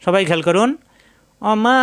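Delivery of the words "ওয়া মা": recited. Shown as a demonstration. Teacher reciting Quran for students, chanted. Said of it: "Quran recitation demo".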